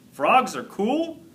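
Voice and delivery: high, slow disbelieving question; can't believe what you heard; incredulous. Acted. Indignant disbelief at poem.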